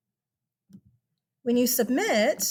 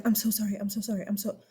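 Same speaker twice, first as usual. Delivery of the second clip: mock apologetic student; 4 dB quieter, faster than usual. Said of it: "some of y'all are like".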